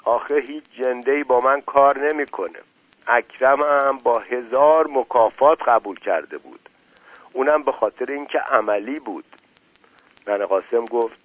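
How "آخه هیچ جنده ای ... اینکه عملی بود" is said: ordinary delivery, nothing marked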